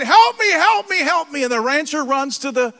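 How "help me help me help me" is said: higher and louder, rushed panic; running for your life, crying out; frantic cry for help. Retold or imitated. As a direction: agent fleeing a bull, frantic pleading. Agent fleeing the bull.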